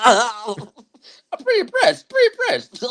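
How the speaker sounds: very high, drawn-out words squeezed through laughter; laughing. Real, not acted. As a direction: crack up mid-quote, stretched slow. Quoting coach while cracking up.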